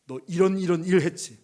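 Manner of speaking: taunting accusation. Retold or imitated. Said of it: "조롱을 하더라고요".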